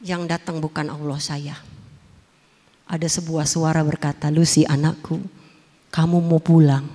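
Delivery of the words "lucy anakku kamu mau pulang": lower, calm divine voice. Retold or imitated. Voice from the sky speaks.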